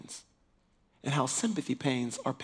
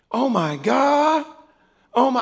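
slow amazement; 9 semitones higher, 9 dB louder, slower than usual. Retold or imitated. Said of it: "It's like".

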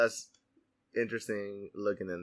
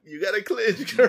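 sassy; 10 semitones higher, 7 dB louder than usual. Pretend. Sassy advice in joke.

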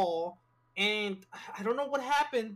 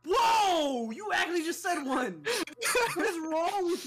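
shocked outburst; 6 semitones higher than usual. Real, not acted. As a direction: exclaim in shock. Reacting to friend's slur.

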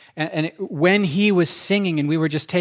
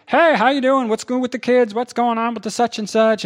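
high, loud, chummy greeter voice; put-on high-pitched pleasantries; mock church small talk; 7 semitones higher, 3 dB louder than usual. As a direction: imitate a chirpy churchgoer making small talk.

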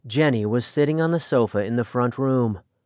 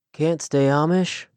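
usual speaking voice

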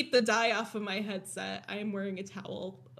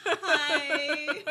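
higher, louder laughing greeting; giggly, raised-pitch hello; 5 semitones higher, 6 dB louder than usual. Real, not acted. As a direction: say it through a real laugh. Answers greeting while both laugh.

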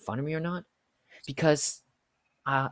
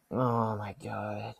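weary exasperation; 3 semitones lower, 3 dB quieter, much slower than usual. Retold or imitated. Exasperated reaction to complaint.

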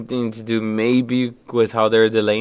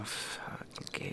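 whispered; 26 dB quieter, much slower than usual. Real, not acted. Muttered joke aside.

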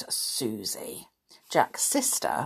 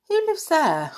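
curious question; 10 semitones higher, 3 dB louder than usual. A narrator's reading. Pam asks about the house.

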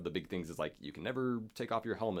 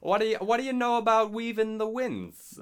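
much higher, louder, hammed-up Boston accent; 9 dB louder than usual. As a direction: belt it like a brash Bostonian. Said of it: "to all be heavily Boston".

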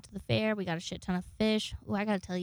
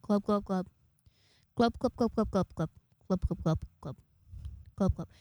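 fish-language eulogy. Pretend. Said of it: "give the eulogy, but in fish language".

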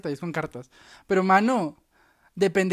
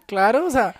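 ordinary delivery

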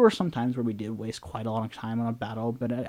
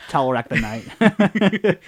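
mock grand title; 4 semitones higher, 8 dB louder, much slower than usual. Mock announcing a character name.

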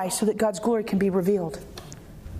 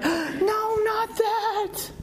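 mock protest; 8 semitones higher, much slower than usual. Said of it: "And you're like".

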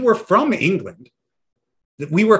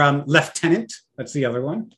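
ordinary delivery

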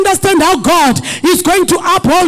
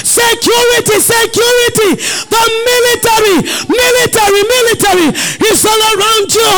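raised, fervent preaching cry; preaching at full force; 5 semitones higher, faster than usual. Acted. Pastor crying out security to the congregation. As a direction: drive it home, shouting to the church.

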